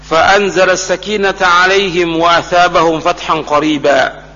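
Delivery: slow, measured Arabic recitation; Quran recitation. Real, not acted. Preacher reciting a verse of Surah Al-Fath to listeners, slow recitation. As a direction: recite the verse slowly, drawing out long vowels.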